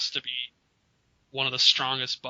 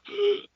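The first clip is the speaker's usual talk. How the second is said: sharp high inhale; gasping wheeze. Shown as a demonstration. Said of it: "you gotta do the".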